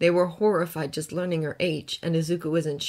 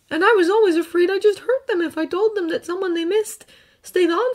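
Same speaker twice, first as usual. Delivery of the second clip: put-on earnest boy, gently recounting; young hero voice; 13 semitones higher, 8 dB louder than usual. Izuku explains.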